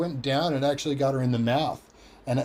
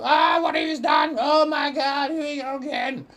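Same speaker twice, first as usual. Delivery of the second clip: 1 exasperated mum; 13 semitones higher than usual. Retold or imitated. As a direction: shriek it like a fed-up mother. Mum reacting to knocked tooth.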